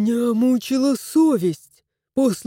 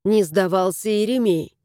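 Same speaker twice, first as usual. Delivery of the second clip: usual speaking voice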